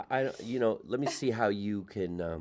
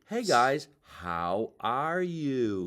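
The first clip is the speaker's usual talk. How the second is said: put-on over-careful, drawn-out standard American accent; mock-proper standard American accent; 4 semitones higher, 3 dB louder, much slower than usual. Comic returning to Jersey friends, mock-proper corrected accent. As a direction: exaggerate a corrected accent, slowing right down.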